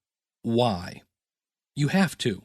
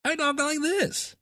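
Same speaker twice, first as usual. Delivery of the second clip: high, slow goofy voice; exaggerated fake talking voice; different put-on voice; 13 semitones higher, slower than usual. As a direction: insist on it in a drawn-out high-pitched voice. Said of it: "that's not how I talk".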